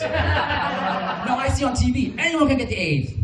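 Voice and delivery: put-on high, insistent Vietnamese-accented mother; imitating mom. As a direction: mimic his mother protesting in her accent. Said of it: "She's like".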